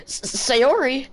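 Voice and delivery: put-on high startled anime girl. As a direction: gasp the name up high. Voicing startled game girl.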